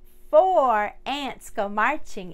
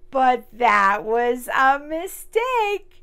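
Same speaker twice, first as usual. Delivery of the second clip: loud and slowed right down; slow loud dramatic; 3 semitones higher, 10 dB louder, slower than usual. Acted. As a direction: warn them loud and slow. Ant hits the pepper.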